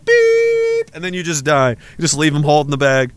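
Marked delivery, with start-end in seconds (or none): 0.1-0.9 high, sustained electronic beep; imitated beep. Device announcing its depleted battery, high imitated beep. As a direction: mimic a device's alert tone.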